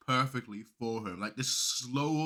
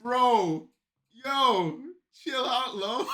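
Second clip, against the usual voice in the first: high, loud, wild hype shouts; hyped shouting; 12 semitones higher, 14 dB louder, much slower than usual. Real, not acted. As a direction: yell along like the crowd at a battle. Hyped reaction to rap bars.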